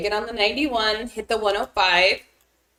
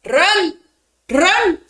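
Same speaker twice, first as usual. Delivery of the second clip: yelling at full volume; shouting; 9 semitones higher, 10 dB louder, slower than usual. Real, not acted. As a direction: shout it out, urgent. Urging player to flee storm.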